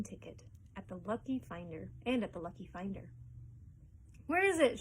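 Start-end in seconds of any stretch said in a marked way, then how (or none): 4.3-4.8 high, loud crowd shout. A narrator's reading. Someone in the crowd shouts for the ticket. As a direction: call out from the back of the crowd. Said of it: "somebody shouted".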